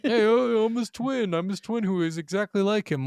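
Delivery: high, silly character voice; goofy long-lost twin. Pretend. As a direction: ham up the cheesy twin reveal. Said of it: "Like a D and D swap".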